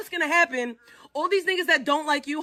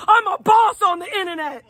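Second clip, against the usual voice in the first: higher, louder mock boast; mocking echo; 3 semitones higher, 3 dB louder than usual. Streamer parroting his friend's boast in a mock voice. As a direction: parrot the brag back in a silly voice.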